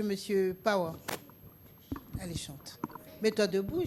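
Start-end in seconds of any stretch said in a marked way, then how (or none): 2.2-2.8 whispered coaxing. Real, not acted. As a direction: urge her softly to sing. Coaxing shy child to sing. (chuchotement encourageant)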